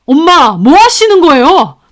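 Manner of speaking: loud, high alarmed protest; son's startled shout; shocked cry. Acted. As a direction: stop your mother mid-throw. Son shocked at thrown chicken.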